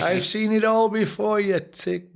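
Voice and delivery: put-on a light Irish accent on the old trainer's saying. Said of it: "but with his Irish accent".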